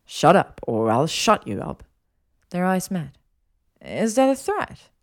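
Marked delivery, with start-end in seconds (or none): 0.0-1.8 punchy start dropping low; low, teasing menace; playful threat. Acted. Draco's playful threat to Hermione. 3.8-4.9 slow, high, teasing question; coy, raised-pitch dare; flirty challenge. Acted. Hermione flirtatiously challenges Draco.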